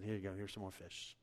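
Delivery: soft, low, obliging reply; meek server. Retold or imitated. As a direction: hand it over quietly and politely. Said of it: "Thomas would have come over ... and said".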